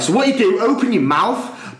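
high-pitched imitation of an over-loud helper; mock loud instructions. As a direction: mimic someone booming out simple instructions. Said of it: "talking me through it, but so loud".

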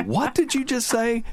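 high, stunned question; can't believe what you heard; shocked disbelief. Retold or imitated. Son-in-law turning to mother-in-law on the beach, stunned. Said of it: "I turn around and go".

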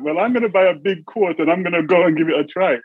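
mum's plucky reply, voiced high; imitated mother. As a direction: lift the pitch, quoting mum's decision. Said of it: "And my mother went".

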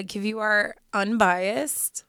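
ordinary talk, nothing marked

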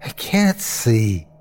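slow, worn-out reply; exhausted. A narrator's reading. Tired narrator to Terry, exhausted. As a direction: drag the words out, tired. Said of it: "I felt so tired".

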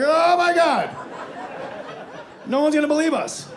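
high, loud mock freak-out; hammed-up shock. Imagined driver freaks out at star.

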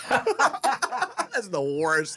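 stretched out, laughing through the words. Real, not acted. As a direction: laugh it out in disbelief. Laughing at the dick joke.

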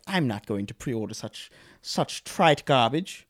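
slow, snooty mock-British voice; hammed-up British snob; snooty British accent. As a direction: put on a haughty British accent, drawing out the words. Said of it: "Wow, nice accent".

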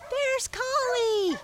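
high, loud, drawn-out lamb cry; joyful lambs. A narrator's reading. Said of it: "cried the lambs joyfully".

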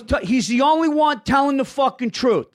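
high, emphatic dad impression; insistent dad. Son imitates dad defending Tucker, high insistent rant. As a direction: imitate a dad ranting with total conviction. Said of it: "He goes".

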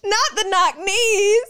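high slow mock horror; drawn-out dramatic dismay; dramatic gasp.

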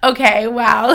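amused friend's reply; laughing. Retold or imitated. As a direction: say it while chuckling.

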